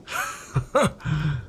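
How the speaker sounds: quiet amused chuckle; a short soft laugh; Bunting laughing softly. A narrator's reading. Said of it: "Bunting began to laugh".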